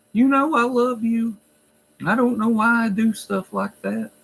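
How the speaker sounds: high, mock-weepy pleading; retold crying. Resident with handful of hair pleading to staff, high mock-crying. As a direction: imitate a tearful apology in a high voice.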